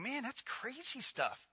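soft, slow disbelieving murmur; quiet slow disbelief; muttered thought. Retold or imitated. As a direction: mutter it half to yourself. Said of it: "You read these verses and".

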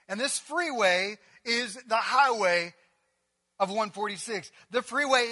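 loud, driving sermon delivery; full-force preaching. Real, not acted. Preacher to the congregation on grace, loud and driving.

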